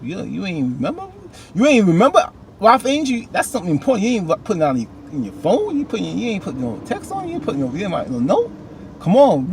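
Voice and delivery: exasperated high-pitched telling-off; scolding Ralph Angel. Retold or imitated. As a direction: tell him off like a fed-up big sister. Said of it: "I'm like".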